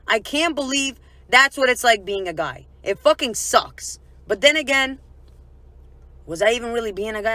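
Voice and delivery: normal talking voice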